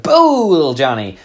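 loud, high boo; shout. Retold or imitated. Brothers jumping out to scare little Johnny, shouting. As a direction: jump out and yell it. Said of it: "we shouted".

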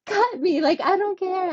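high, pushed plea to cut; desperate high plea. Retold or imitated. Woman in labor begging the doctor, high desperate plea.

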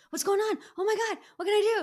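high, loud, fast fretting; hammed-up flustered overreaction; mock panic. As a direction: fire off frantic questions in hammed-up alarm. Acted panicky overreaction to someone's anger.

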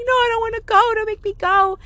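very high retold pleading; panicked refusal. Child refusing to fetch sister's laundry, acted high panicked plea. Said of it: "I was panicking".

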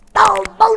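high, loud "pam, pam" punches; imitated punch sounds. Boxer retelling his knockout blows, high loud punch noises.

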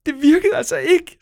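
high, loud despairing shout; screaming. Acted. Nora screams at the swear words that it didn't work. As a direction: yell it in frustration, near tears. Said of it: "skreg Nora til dem".

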